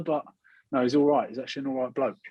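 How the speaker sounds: usual speaking voice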